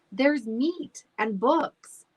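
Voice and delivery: slow, delighted and loud; happy dog voice. Acted. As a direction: savor every word. Dog praising school.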